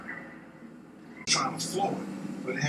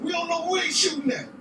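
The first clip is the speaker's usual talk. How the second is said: much higher, voice cracking with a cry; frantic, near tears; 14 semitones higher than usual. Retold or imitated. Kenny in the car after the shots, frantic and near tears. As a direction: blurt it in panic, almost crying.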